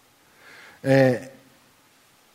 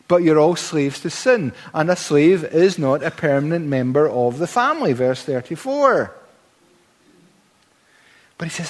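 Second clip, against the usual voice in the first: ordinary talk, nothing marked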